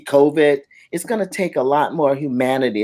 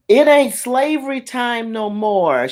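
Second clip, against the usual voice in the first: slow, firm and a little higher; mother's slow warning; 7 semitones higher than usual. Retold or imitated. Mother repeating her warning to her children, slow and firm. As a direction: lay down the truth slowly, like mama did. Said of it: "She said".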